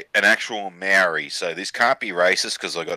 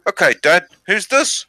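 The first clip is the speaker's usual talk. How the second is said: high mock Maori accent, rising question; chief's son; 9 semitones higher than usual. Son testing his dad, high mock Maori accent. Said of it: "He goes".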